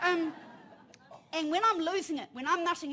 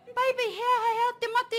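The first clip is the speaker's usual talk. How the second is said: high, louder, half-laughing mum voice; mother comforting; 9 semitones higher, 8 dB louder than usual. Retold or imitated. Mother asking her frightened son what is wrong, higher and laughing. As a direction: coax the child with a laugh in the voice. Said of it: "And I'm like".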